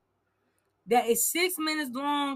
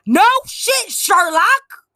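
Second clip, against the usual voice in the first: high, loud mocking retort; sarcastic shout; 4 semitones higher, 12 dB louder, much slower than usual. Real, not acted. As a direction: yell it mockingly.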